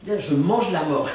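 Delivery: usual speaking voice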